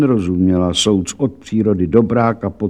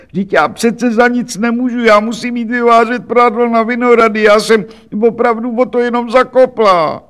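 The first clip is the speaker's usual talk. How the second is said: put-on much higher, louder wailing old washerwoman; old laundress pleading; 11 semitones higher, 5 dB louder than usual. Laundress begging the official, higher and shrill-free wailing. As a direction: play a frantic poor laundress.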